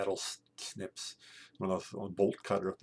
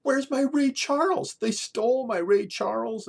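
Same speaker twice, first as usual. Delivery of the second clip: much higher, loud mock outrage; frantic yelling roommate; 12 dB louder than usual. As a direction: yell like a roommate who can't find his record. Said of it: "after a while he goes".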